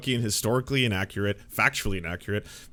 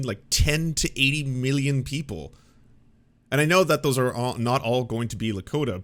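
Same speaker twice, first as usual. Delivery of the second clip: ordinary talk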